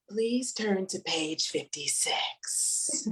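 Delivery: slow, low, soft sultry teacher voice; sexy phone voice. Retold or imitated. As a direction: read it like a seductive late-night caller. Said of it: "phone sex voice".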